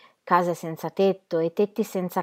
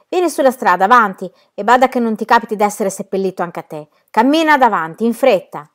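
loud, raised-pitch command; barked order; 4 semitones higher, 9 dB louder than usual. Acted. Gunman forcing Tea Cake into burial work, loud threatening command. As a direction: order him to march at gunpoint. Armed man orders him to march.

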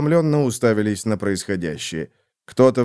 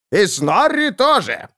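Snorri chiming in; 10 semitones higher, slower than usual. Acted. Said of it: "добавил Снорри".

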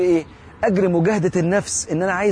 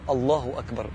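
بإحساس; 3 semitones lower, 5 dB quieter than usual. Shown as a demonstration. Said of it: "حس". (تكبيرة هادية على مهل، من القلب)

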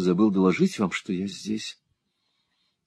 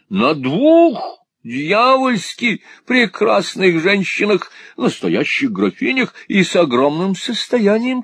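high, drawn-out foppish insistence on «двух»; exclaimed boast; 11 semitones higher, 4 dB louder, slower than usual. A narrator's reading. Husband boasting to jealous wife, high exclamation. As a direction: stretch «двух» high, then gush. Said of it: "вскричал Монталини".

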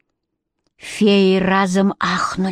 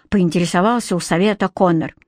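ordinary delivery, nothing marked

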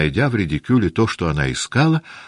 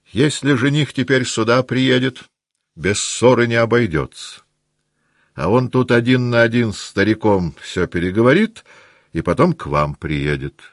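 higher, animated voice settling at the end; persuasive advising matron; 6 semitones higher than usual. A narrator's reading. Marya Dmitrievna advising the family to leave, briskly persuasive. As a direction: lay out the plan emphatically.

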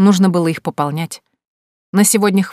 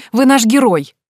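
excited; 6 semitones higher, 6 dB louder than usual. Acted. Friend cheering the hero.

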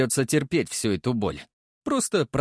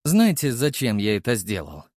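usual speaking voice

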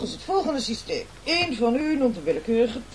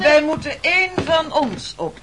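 eager shout; 4 semitones higher, 7 dB louder than usual. Acted. Eager agreement to eat someone.

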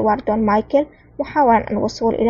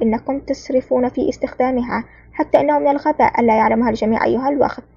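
plain reading voice

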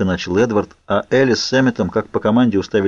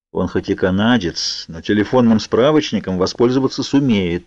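plain reading voice

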